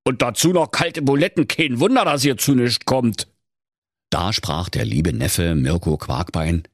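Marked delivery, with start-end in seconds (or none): 0.1-3.3 put-on high, indignant old miser ranting; indignant skinflint rant; scornful miser. A narrator's reading. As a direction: scold the spendthrifts. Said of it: "Da knurrte der Knöterich".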